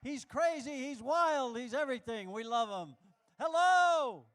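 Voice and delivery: loud, high, stretched announcer call over music; announcer hype. Real, not acted. MC firing up the room for Lorenzo over music, shouting. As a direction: work the crowd like an MC.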